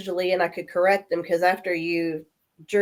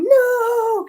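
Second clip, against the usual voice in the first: much higher, louder wailing no; anguished no; 6 dB louder than usual. Retold or imitated. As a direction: heartbroken fans crying out at the news. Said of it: "all these guys going".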